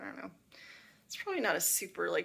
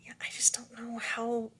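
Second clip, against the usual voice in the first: whisper; 2 semitones higher, 4 dB quieter than usual. Real, not acted.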